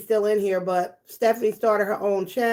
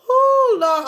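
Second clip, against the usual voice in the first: shrieking; 13 semitones higher, 5 dB louder than usual. Real, not acted. Overwhelmed by chat.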